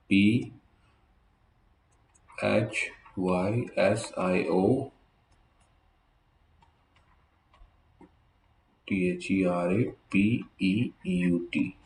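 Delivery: letters spelled out slowly and softly; slow spelling. Shown as a demonstration. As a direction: spell each letter with a pause. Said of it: "जो spelling बोल रहा हूँ". (धीरे-धीरे अक्षर बोलना)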